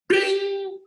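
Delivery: high, sharp mock bing; a quick high beep; notification ding. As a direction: imitate the chime. Imitating message notification sound.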